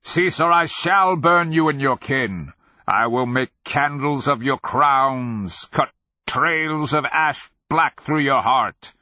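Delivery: loud, high declaimed fury; raging threat. A narrator's reading. Wizard threatening to burn his captors, raging. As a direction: thunder the curse, stretching the words. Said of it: "he raged".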